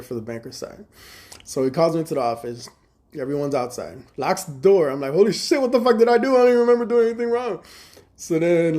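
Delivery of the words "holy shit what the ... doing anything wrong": high, loud and fast panicked blurt. Retold or imitated. Called in, door locked, panicking.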